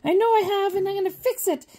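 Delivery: high, squeaky toy-figure voice; high play voice. Pretend. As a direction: voice the little figure, high and bright. Voicing Leia figure in play.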